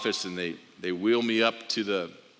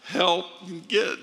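desperate cry for help; 8 semitones higher than usual. Retold or imitated. Falling to the bathroom floor.

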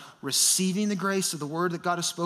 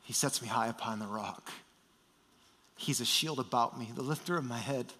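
much lower and softer, tender reassurance; voice of Jesus; 7 semitones lower, 8 dB quieter than usual. Acted. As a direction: speak it gently, like comforting a frightened child. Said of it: "The voice of Jesus says".

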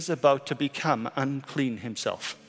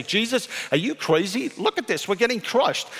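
mock-incredulous disciples, pitched higher; exasperated disciples; 8 semitones higher than usual. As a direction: toss it off like jostled, fed-up followers. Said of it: "The disciples say".

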